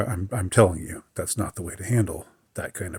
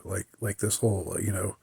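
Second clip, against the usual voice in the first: ordinary talk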